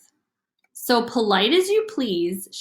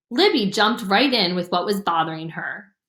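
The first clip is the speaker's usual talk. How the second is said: plain reading voice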